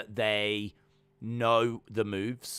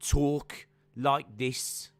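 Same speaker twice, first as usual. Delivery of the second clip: very slow, raised Birmingham impression; exaggerated slowed-down Brummie voice; Pete Dunne; 4 semitones higher, much slower than usual. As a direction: stretch each word out like a slowed promo. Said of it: "so he has to talk like this".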